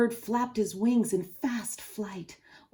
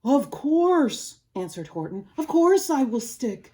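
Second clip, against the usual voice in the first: louder, firm, slowed promise; loyal Horton; 6 semitones higher, 9 dB louder than usual. Acted. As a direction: pledge steadfastly.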